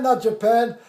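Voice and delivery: normal talking voice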